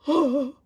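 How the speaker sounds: sharp loud gasp; loud high gasp. Real, not acted. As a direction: gasp in delight. Startled by pull.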